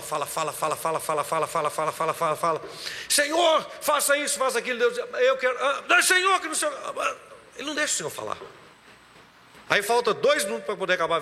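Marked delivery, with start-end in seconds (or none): 0.0-2.6 low, monotonous run of repeated words; droning, relentless repetition; nonstop chatter. Retold or imitated. Mimicking someone who talks nonstop. 3.1-6.7 higher, pleading vigil prayer; tumbling, impatient mock prayer; mock nonstop pray-er. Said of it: "acha que na vigília tem que gritar".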